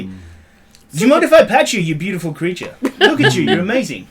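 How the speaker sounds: high-pitched cooing to an animal; playful gushing with a laugh in it; gushing compliments. Acted. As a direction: coo adoringly, as if to a beloved pet. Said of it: "give him compliments and say".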